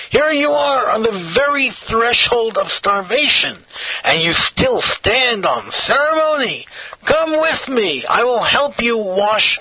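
high-pitched, insistent storyteller's plea; exasperated urging. A narrator's reading. Stranger urges the starving man.

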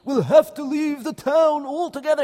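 high, loud shocked outcry; alarmed. Acted. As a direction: gasp it out in disbelief. Rabbi shocked at the news.

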